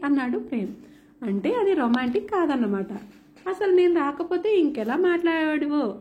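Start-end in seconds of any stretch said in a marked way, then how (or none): none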